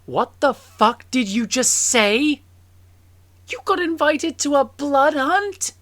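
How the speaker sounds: loud, high-pitched incredulous outburst; outraged disbelief. Acted. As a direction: demand it, stunned and appalled, voice shooting up. Character reacts to blood hunt news.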